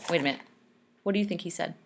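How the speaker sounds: ordinary delivery, nothing marked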